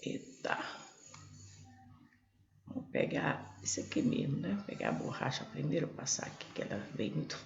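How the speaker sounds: muttered aside. Real, not acted. Teacher mutters to herself while erasing.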